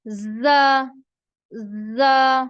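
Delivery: "जा" said loud and forceful with a hiss; loud drilled "जा" for the class; forceful letter demo. Shown as a demonstration. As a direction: hit "जा" hard, teacher modelling. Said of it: "इसको आपने जोर से अदा करना है".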